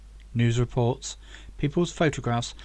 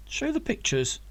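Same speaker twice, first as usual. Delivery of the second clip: eager; 8 semitones higher than usual. A narrator's reading. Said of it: "said Zach eagerly".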